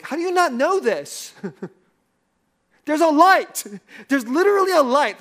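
mock-exasperated wife, high and loud; irritated wife. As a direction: scold him like he should know better. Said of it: "she was pretty irritated".

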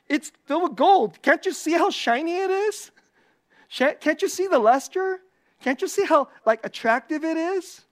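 high-pitched, eager mock boasting; playful show-off pitch with rising questions. Acts out boasting of a golden jar.

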